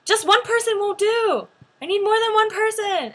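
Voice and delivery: high, loud demand; hammed-up insistent call; insistent ajumma. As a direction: imitate a pushy ajumma announcing to the line. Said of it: "And she's like".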